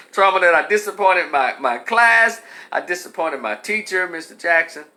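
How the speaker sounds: usual speaking voice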